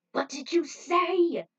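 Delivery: loud, slow, shocked demand; stunned shout. A narrator's reading. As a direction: bark it back in disbelief. Stunned reply.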